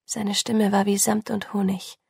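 ordinary delivery

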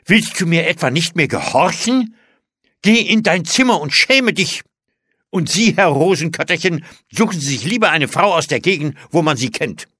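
indignant, loud, high scolding; empört. A narrator's reading. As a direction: dismiss them both in outrage. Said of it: "rief die Tante empört". (laut und empört schimpfend)

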